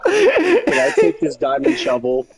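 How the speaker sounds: high squeaky laugh through the question. Real, not acted. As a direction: ask it while cracking up. Joking request mid-chat.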